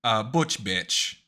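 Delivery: loud broken-English warrior threat; angry shout. Acted. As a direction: bellow it at the enemy, furious. Character yells threat at villain.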